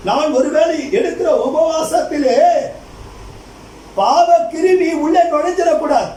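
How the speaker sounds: ringing pulpit exhortation; preaching. Acted. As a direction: exhort the congregation with force. Preaches with raised pitch.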